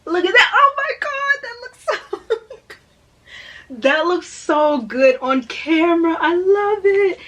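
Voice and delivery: high, loud, laughing delight; gushing. Real, not acted. Showing page art to viewers, high laughing gush. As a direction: squeal over the pretty book.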